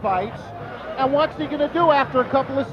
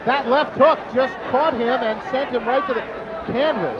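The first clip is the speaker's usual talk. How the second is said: play-by-play. Real, not acted. Live call of the knockdown.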